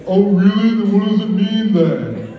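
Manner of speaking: high, slow put-on girlfriend voice; girlfriend impression. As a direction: mimic her sassy challenge. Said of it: "an impression of my girlfriend".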